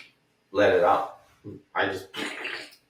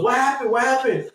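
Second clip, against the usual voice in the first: much higher, worried repeat; Danny alarmed; 13 semitones higher than usual. Retold or imitated. Danny asking what happened, high alarmed voice. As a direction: ask twice in alarm. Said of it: "And he goes".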